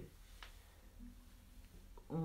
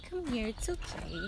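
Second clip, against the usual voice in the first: sing-song high reassurance; high coo; 8 semitones higher, 5 dB quieter than usual. Real, not acted. To shy pets, a high soothing coo.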